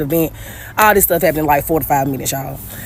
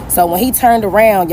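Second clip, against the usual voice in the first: usual speaking voice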